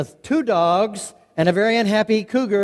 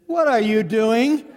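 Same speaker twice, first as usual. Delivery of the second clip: slow, higher-pitched incredulous question; acted bafflement; 4 semitones higher, slower than usual. Uncle baffled by nephew's barking.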